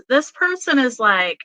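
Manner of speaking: ordinary delivery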